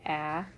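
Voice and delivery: "ah" as the short a in happened; short a sound. Shown as a demonstration. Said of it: "I hear a short A".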